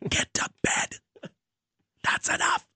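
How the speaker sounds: angry whisper, fast; a furious dad scolding under his breath. Pretend. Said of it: "very intimidating dad last night".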